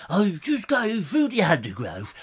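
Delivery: drawn-out TV naturalist impression; slow, hushed nature presenter wandering along; David Bellamy. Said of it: "do your David Attenborough or your, uh, David Bellamy".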